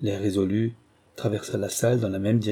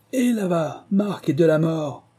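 calling out; 7 semitones higher than usual. A narrator's reading. The prince shouts for his two men across the hall. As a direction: summon them from the far end of the room. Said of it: "cria-t-il".

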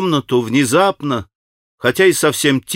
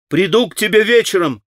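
higher, called out after him; shouted call; 7 semitones higher than usual. A narrator's reading. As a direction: call across the room while leaving. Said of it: "крикнул Алёша".

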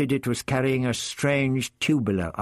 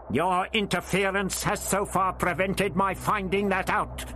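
put-on high, firm old man insisting; old Doctor, firm; 8 semitones higher than usual. A narrator's reading. The Doctor bargains with the voice, firm. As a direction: stand your ground, lay out the facts.

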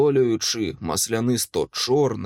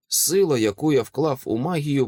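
ordinary delivery, nothing marked

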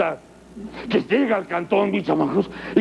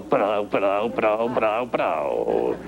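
mock rhythmic grunts; 9 semitones lower, much slower than usual. Wolf imagining his move.

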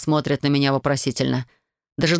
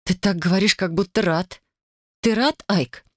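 through clenched teeth; 4 semitones higher than usual. A narrator's reading. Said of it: "цедит".

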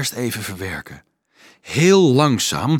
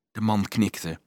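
ordinary delivery, nothing marked

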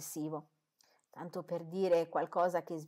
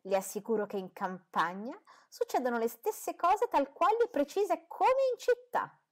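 rising, slowing, indignant insistence; resentful retort; 9 semitones higher, 6 dB louder than usual. A narrator's reading. Mrs Bennet retorts to Darcy, resentful and indignant, voice climbing high. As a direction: insist as if personally offended. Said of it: "risentita da quelle sue allusioni".

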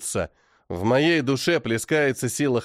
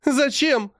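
high, desperate cry; 9 semitones higher, slower than usual. A narrator's reading. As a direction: she weeps over her dying husband. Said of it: "плачет она". (плачущий вскрик)

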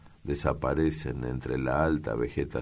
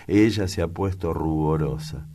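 plain reading voice

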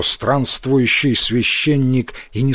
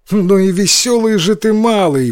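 высокий возглас, разыгранный за разбойника; воскликни выше обычного, как развеселённый разбойник; 8 semitones higher, 4 dB louder than usual. A narrator's reading. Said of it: "воскликнул разбойник". (восклицание на повышенном тоне)

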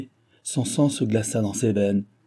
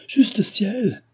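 very high-pitched, horrified exclamation; exclaimed in dismay; 12 semitones higher than usual. A narrator's reading. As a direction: cry out to heaven, aghast. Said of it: "s'écria Delville".

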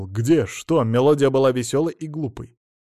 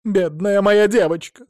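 high, loud, slow lament; wailing outcry; 8 semitones higher, 6 dB louder than usual. A narrator's reading. Mother-in-law pities her cheated daughter, loud wail. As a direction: wail for your wronged daughter. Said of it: "тут же завопила тёща".

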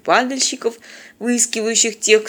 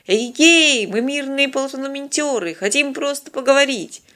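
calling out; 6 semitones higher than usual. A narrator's reading. Envoy hailing the old man's fortress, calling out. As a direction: call across to someone at a distance.

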